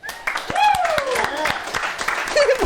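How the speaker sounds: very high, real burst of laughter; high squealing laugh. Real, not acted. Streamer reacting to the roast video, high squealing laugh.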